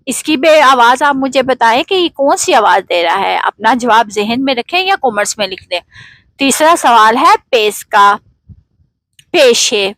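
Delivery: ordinary talk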